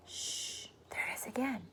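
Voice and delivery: hushed unvoiced whisper. A narrator's reading. James hushing the others to listen, whispering. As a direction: whisper so the voice can be heard. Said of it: "whisper in scene".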